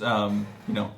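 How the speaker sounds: ordinary delivery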